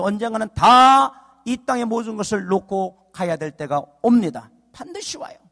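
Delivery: fervent pulpit delivery; impassioned preaching. Real, not acted. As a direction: exhort the congregation with swelling force. Preaching to congregation.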